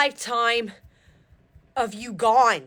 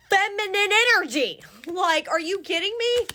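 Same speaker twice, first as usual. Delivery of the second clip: much higher, thrilled disbelief; excited disbelief; 10 semitones higher than usual. Real, not acted. Reading cards to viewers, thrilled disbelief at the spread. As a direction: squeal it out, can't believe the cards.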